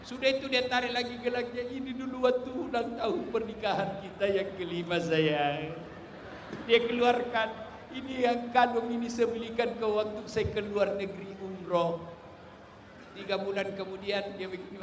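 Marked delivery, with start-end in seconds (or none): 1.6-5.8 mock grieving widower. Voicing grieving rich man. 7.9-12.0 mock grieving widower. Voicing grieving rich man.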